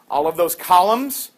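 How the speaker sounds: normal talking voice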